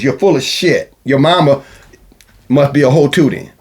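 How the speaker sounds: normal talking voice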